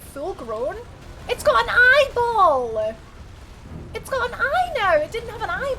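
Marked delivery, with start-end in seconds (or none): 1.3-5.7 high, loud aghast cry; freaked out by the eyeball; shocked exclaim. Real, not acted. Shocked at creature.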